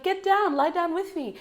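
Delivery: high, sing-song imitation of a kids' show host. Recalling the children's show host, high playful imitation. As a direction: mimic the gentle host calling kids down. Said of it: "she'd be like".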